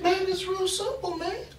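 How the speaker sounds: squeaky matter-of-fact reply; high squeaky prisoner. Retold or imitated. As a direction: prisoner explaining why to pray. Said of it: "He said".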